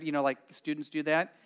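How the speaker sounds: ordinary talk, nothing marked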